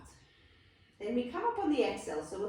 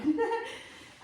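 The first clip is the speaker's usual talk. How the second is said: a high burst of giggling; amused laugh; 13 semitones higher than usual. Real, not acted. Teacher laughs at her silly-looking plow variation. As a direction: laugh at yourself.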